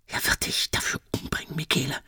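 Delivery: whispered cold warning; whispering. Acted. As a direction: breathe it, no voice. Rosa answers the threat quietly.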